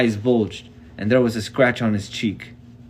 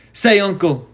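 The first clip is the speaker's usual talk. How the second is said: higher, louder order to give up; stern command; 9 semitones higher, 5 dB louder than usual. A narrator's reading. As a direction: demand surrender. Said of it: "Mark told him".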